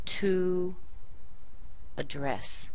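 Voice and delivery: "To address" with long To, pause, then uh-DRESS; verb stress. Shown as a demonstration. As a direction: stress the second syllable of "address" after a small schwa. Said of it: "put it on the second syllable".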